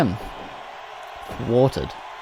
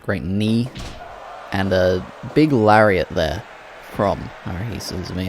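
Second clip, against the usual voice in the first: ordinary talk, nothing marked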